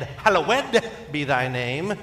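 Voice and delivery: "Hallowed" drawn into three syllables, loud on the ed; slow, emphatic "hal-lo-WED" with the last syllable punched; stressed -ed ending. Shown as a demonstration. Preacher quoting hardcore King James style, stressed -ed ending. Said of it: "You put the emphasis on the ed".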